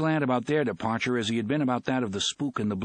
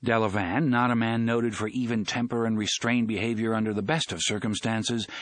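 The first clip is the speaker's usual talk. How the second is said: plain reading voice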